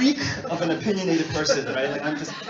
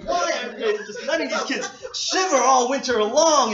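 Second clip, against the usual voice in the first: retold complaint; 6 semitones higher, 5 dB louder than usual. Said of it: "I would just complain".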